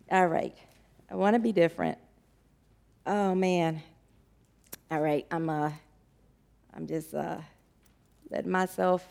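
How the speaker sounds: fighting to keep from crying; real tearful near-silent voice; holding back tears. Real, not acted. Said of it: "y'all keep making me cry".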